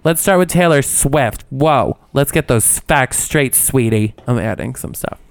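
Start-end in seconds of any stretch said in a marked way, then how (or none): 0.0-4.1 theatrical, starting high then settling; hammed-up indignant open letter; dramatic reading. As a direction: play up the drama of each phrase. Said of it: "I'm gonna do a dramatic reading".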